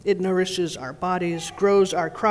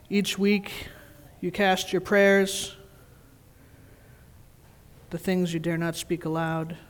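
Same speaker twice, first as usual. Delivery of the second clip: hushed prayerful reading; 4 dB quieter than usual. Real, not acted. Minister guiding the congregation in soft prayerful tone. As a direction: speak softly as if in prayer.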